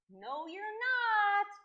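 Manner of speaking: high, loud, drawn-out denial; loud contradiction. Acted. Dragons about to show real fire, loudly contradicting. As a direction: stretch it out and swell on NOT.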